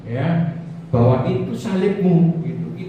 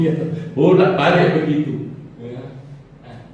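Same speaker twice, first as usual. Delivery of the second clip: ordinary talk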